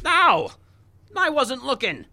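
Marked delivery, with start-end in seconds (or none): 0.0-0.5 high loud yelp of pain; a sharp cry of pain. Acted. Comedian yelping after a punch, loud and high.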